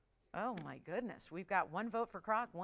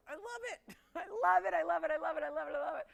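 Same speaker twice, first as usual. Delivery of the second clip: giggling, high rapturous repeats; laughing delight; 5 semitones higher, faster than usual. Real, not acted. Gasping delight over the piece.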